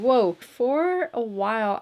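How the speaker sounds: normal talking voice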